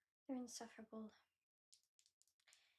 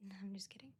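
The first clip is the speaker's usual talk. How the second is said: ordinary talk, nothing marked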